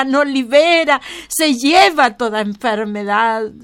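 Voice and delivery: loud, high-pitched preaching at full force; fervent preaching. Acted. Preacher praising God's healing before the congregation, fervent and loud. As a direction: proclaim it to the whole congregation.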